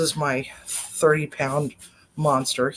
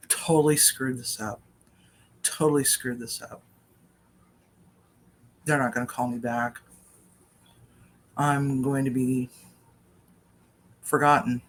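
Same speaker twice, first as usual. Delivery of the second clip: retold dejection; 3 semitones lower than usual. Retold despair after losing the interview.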